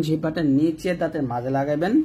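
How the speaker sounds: ordinary delivery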